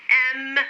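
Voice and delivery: "M" with a long, held hum at the end; teacher modelling "M" clearly, stretched out; sounded-out letter. Shown as a demonstration. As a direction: hold the m sound of "M". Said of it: "Répétez après moi".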